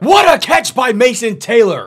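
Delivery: thrilled shout at a big catch; real excited shout; excited yell. Real, not acted. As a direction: yell it out in delight. Great catch in the game.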